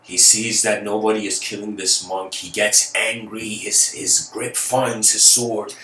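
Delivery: ordinary delivery, nothing marked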